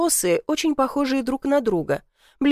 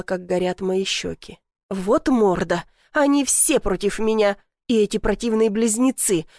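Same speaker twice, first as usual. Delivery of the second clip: ordinary delivery, nothing marked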